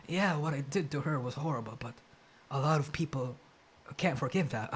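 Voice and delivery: low, quiet mock rasp with a tremble; mocking shaky raspy voice. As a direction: imitate a forced, shaky apology. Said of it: "the shaking in the voice, the forced rasp".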